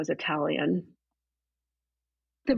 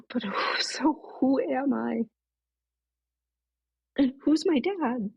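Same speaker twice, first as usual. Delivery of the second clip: bewildered question; 7 semitones higher than usual. Retold or imitated. Bewildered inner quote.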